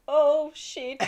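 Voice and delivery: exaggerated taken-aback reaction; imitated dismay. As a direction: act out his excitement falling into dismay. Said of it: "go from, like, excited to".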